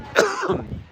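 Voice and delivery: a real dry cough from yelling; hoarse cough. Real, not acted. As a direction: cough from a worn-out throat. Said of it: "Sorry, I'm coughing".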